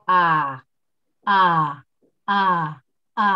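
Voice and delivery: middle-pitch "A" from the diaphragm; demonstrating the belly voice. Shown as a demonstration. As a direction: push each "A" from the stomach. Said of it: "yang disebut dengan suara perut".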